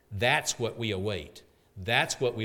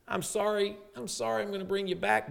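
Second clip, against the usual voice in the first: apologetic Jesus; 10 semitones higher than usual. Acted.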